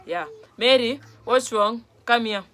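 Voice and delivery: ordinary talk, nothing marked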